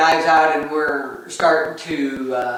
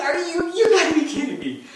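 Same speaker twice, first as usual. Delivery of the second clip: amused disbelief; 12 semitones higher than usual. Retold or imitated. Dad repeats disbelief at son.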